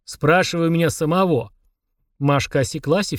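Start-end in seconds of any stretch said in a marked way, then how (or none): none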